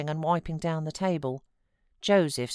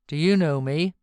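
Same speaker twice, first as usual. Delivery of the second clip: gruff question; 6 dB louder than usual. A narrator's reading. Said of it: "asked Heathcliff".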